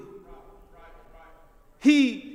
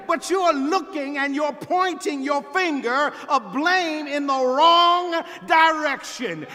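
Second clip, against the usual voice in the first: raised, emphatic pulpit voice; forceful preaching; 3 semitones higher, 3 dB louder than usual. Real, not acted. Preacher telling the congregation God has not moved, forceful preaching. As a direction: drive each phrase home with sustained force.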